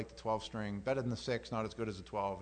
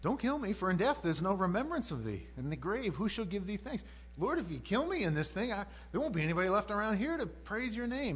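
high acted appeal; dramatized bargaining plea; pleading for life; 8 semitones higher, 3 dB louder than usual. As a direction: argue desperately with God, pitch raised. Voicing David begging for his life.

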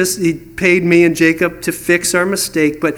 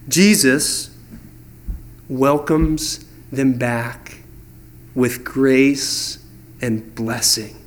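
tender; 6 semitones lower, much slower than usual. Real, not acted. Preacher telling the congregation of Jesus' welcome, low tender and slow. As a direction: let grace land gently. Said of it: "preacher softens on grace".

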